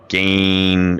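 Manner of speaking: normal talking voice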